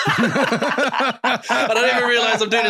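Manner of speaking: ordinary talk, nothing marked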